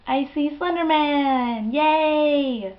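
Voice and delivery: high, loud, drawn-out mock excitement; big sarcastic yay; mock cheer. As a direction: cheer it slowly with fake delight. Mock cheer at seeing monster.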